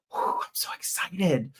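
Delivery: breathy whispered thrill; excited whisper. Real, not acted. To viewers, whispers his excitement. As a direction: whisper it like a happy secret.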